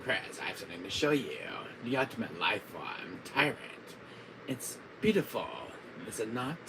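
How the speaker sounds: softly gloating villain; hushed villain showing off a creation; Wesker. Acted. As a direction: reverent mastermind murmur. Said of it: "my Wesker voice".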